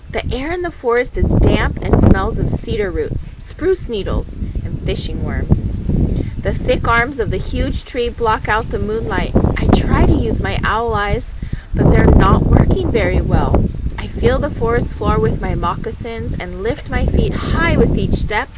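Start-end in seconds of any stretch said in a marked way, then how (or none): none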